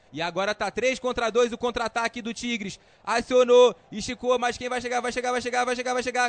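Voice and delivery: urgent play-by-play climbing in pitch; rising excitement. Real, not acted. Counterattack building toward goal. (narração crescendo de emoção)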